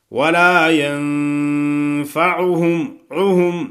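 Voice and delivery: ینفعهم with a clear throat ع; clear ain. Shown as a demonstration. Teacher stresses the ع of ینفعهم. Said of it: "عین کو اپنے مخرج سے ادا کرنا ہے".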